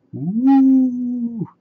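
high drawn-out ghostly woo; spooky cave wail. Acted. Brother spooking his sister as the cave, high ghostly wail. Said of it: "read Brother with lots of expression".

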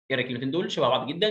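ordinary talk, nothing marked

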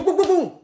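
loud, high booming knocks; imitated door banging. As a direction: pound out the knocks by voice. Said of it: "Il va frapper à la porte".

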